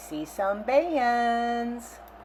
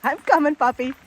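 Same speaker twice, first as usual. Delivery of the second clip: raised voice, calling to a puppy; 7 semitones higher, 8 dB louder, faster than usual. Real, not acted. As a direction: call out across the beach. Calling to a dog.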